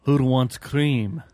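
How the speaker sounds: slow, stretched-out question; drawn-out catchphrase. Retold or imitated. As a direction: drag out every word.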